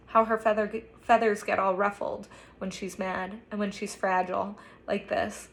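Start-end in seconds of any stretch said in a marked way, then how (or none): none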